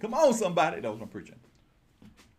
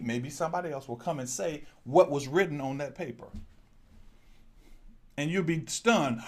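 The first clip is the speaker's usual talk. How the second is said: usual speaking voice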